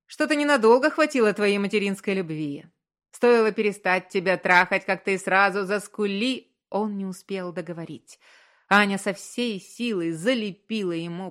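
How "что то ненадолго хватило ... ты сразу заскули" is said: loud, biting jeer; angry taunt. A narrator's reading. Husband taunting his wife mid-quarrel, angry and scornful. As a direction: sneer at them with raised voice.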